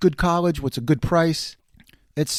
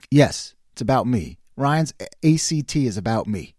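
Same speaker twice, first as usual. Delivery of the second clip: ordinary talk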